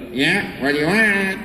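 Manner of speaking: hint of a timid younger brother; Luigi voice. Luigi calling back to Mario, lightly voiced. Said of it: "And then I'd go".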